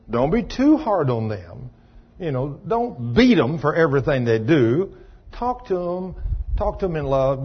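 usual speaking voice

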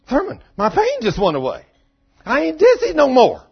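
high, loud amazed exclamation; a healed woman shouting it to the room; excited cry. Retold or imitated. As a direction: blurt out the miracle in joy.

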